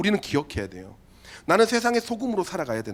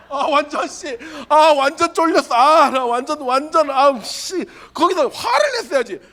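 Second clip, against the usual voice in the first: mock frustration; 7 semitones higher, 6 dB louder, slower than usual. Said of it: "self-mocking regret, laughing".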